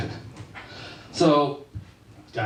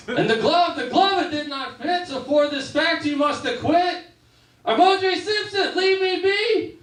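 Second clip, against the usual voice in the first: much higher, sing-song storybook chant; Dr. Seuss rhyme; 8 semitones higher than usual. Retold or imitated. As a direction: recite a whimsical rhyming plea on a stump. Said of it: "the Doctor Seuss defense".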